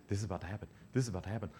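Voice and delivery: soft, mildly nervous acted self-talk; jittery muttering. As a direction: mutter it to yourself, a touch antsy. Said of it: "he's, like, real jittery".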